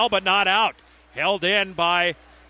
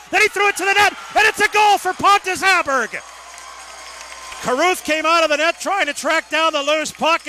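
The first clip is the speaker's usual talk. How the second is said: goal call; 7 semitones higher than usual. Real, not acted. Radio announcer calling the Admirals' power play goal, excited shout. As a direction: belt it out like the crowd just erupted.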